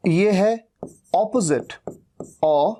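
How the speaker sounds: ordinary talk, nothing marked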